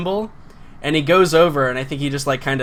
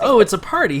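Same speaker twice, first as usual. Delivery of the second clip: higher, louder gleeful "Oh, it's a party"; sleazy amused; 7 semitones higher, 5 dB louder than usual. Acted. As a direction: sell it like a delighted creep. Guard line redone with more energy.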